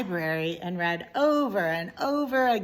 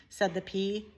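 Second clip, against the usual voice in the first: ordinary delivery, nothing marked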